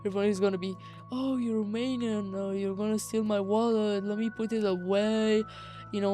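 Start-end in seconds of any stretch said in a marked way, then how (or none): none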